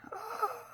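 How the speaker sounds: quick high breathy gasp of delight; sharp gasp. Real, not acted. Crafter seeing her fabric sample, delighted gasp. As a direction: gasp as if it's gorgeous.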